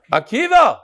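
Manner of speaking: teacher calling a student from across the room. Real, not acted. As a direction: shout a name to someone far off. Calling a kid from afar.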